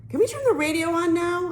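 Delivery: put-on high, pleading kid; whiny high plea; begging. A narrator's reading. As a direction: beg mom from the back seat. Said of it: "I begged my mom".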